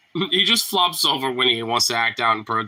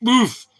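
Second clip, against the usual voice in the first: sharp high grunt of impact; a jolted oof as the dog hits; startled oof; 11 semitones higher, 6 dB louder than usual. Real, not acted. Jolted by the dog, a sharp high oof.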